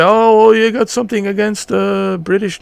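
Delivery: hammed-up imitation of someone taking offence; mock accusing quote. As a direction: toss out the critic's accusation in a put-on voice. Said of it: "some people say".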